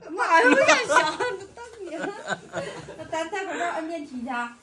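high, giggling through it; laughing. Real, not acted. Mom laughs as the kids head for the elevator, giggling. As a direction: talk while still laughing.

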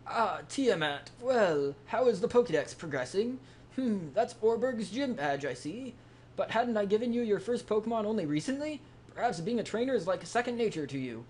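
lightly voiced pondering old professor. Reads professor NPC dialogue.

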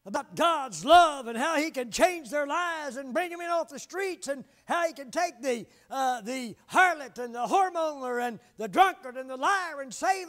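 loud, high-pitched pulpit drive; booming revival sermon voice; full-force preaching. Acted. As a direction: preach it out to the whole congregation.

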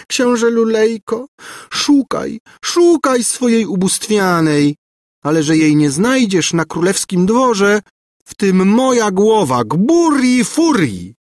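high, gloating villainess; screeching taunt. A narrator's reading. Governess daring the prince to search, screeching. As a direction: taunt him shrilly, stretching out your own name. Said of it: "skrzeczała za nim Gburja Furia".

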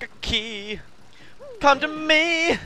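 high, slow mock-mystic call; silly summoning voice. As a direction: summon the key like a wizard.

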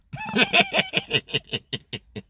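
high-pitched rapid giggle; laughing. Real, not acted. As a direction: burst into a long, very high cackle. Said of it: "Neesekereko maama".

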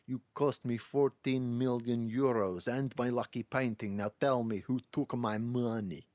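mock Russian villain accent that slides toward Schwarzenegger; a lightly hammed-up Russian heavy demanding his money. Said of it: "More Schwarzenegger as it goes".

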